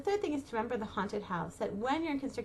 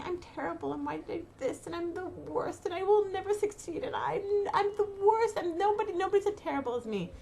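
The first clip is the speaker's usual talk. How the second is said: higher, whiny self-criticism that builds; mock self-pity; 7 semitones higher than usual. Mimicking a mind full of self-blame, a hint of a whine. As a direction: pile on the self-blame, slightly overdone.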